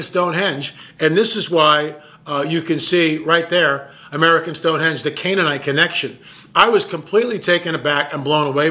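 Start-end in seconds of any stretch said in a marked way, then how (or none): none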